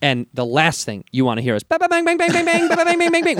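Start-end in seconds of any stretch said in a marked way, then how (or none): 1.8-3.4 high popping bang bang imitation; mimicked gunshots, much higher; gunshot noises. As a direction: rattle off mock gunfire.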